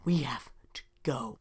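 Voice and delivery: hushed, breathless urgency; urgent whisper. Acted. Hero whispering to his friend to flee. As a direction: whisper it so the guards can't hear.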